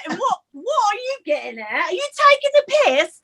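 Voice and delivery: high-pitched mock British challenge; exaggerated British accent, querying; imitated British accent. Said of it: "guys that were British".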